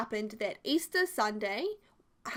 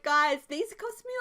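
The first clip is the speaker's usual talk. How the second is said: slow, higher, louder mock protest; mock indignant; 5 semitones higher, 5 dB louder than usual. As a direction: complain like it's a big deal. Complaining to family about chocolates.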